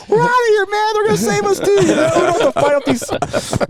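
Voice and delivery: thrilled to be rescued; excited. Retold or imitated. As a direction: burst out with rescued joy. Retold joy at rescue.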